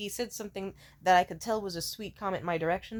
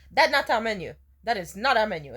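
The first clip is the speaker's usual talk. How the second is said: put-on louder, huffy waiter in a broken English accent; 3 semitones higher, 8 dB louder than usual. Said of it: "I know you might think that from the accent".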